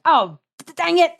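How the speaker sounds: high, loud burst of mock frustration; sputter, then burst out in pretend anger; mock outrage. Baba Yaga looking in the oven, mock outrage. Witch finds her daughter roasted.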